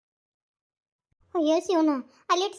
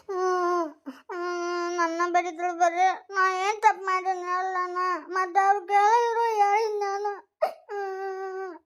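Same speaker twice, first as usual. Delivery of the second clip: sobbing wail; 3 semitones higher, slower than usual. Acted. Scolded daughter sobs and wails.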